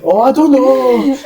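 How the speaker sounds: high, drawn-out mock Chinese accent through laughter; laughing, badly faked Chinese accent. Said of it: "get a Chinese dialect".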